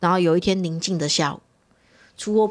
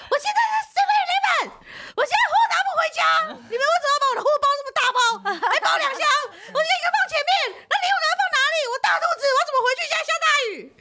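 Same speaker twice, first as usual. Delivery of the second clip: much higher rapid shrieking rant, near tears; hysterical ranting; faster than usual. Retold or imitated. Stranded pregnant customer scolding the shop by phone, fast shrill rant. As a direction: rattle off the complaints in a panicked shriek. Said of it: "然后就是歇斯底里就一直骂".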